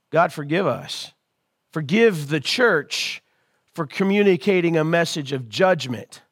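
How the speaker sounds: soft, low-pitched prayer; hushed plea for forgiveness; quiet prayer. Acted. Pastor praying for the church, soft hushed prayer.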